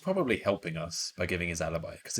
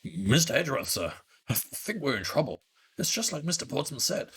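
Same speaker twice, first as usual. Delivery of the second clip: higher, stammering voice of a worried underling; worried subordinate; 6 semitones higher than usual. Acted. Underling telling Mister Edgeworth they're in trouble, worried. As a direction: report trouble nervously to your superior.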